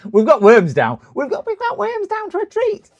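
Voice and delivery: piping caricature of a viewer; impression of Worms. Streamer mimicking viewer Worms in a high squeaky voice. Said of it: "that's how he sounds".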